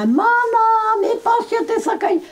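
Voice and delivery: calling out. Retold or imitated. Old woman reenacting calling her mother back from the fire, high cry. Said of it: "εμείς τη φωνάζαμε".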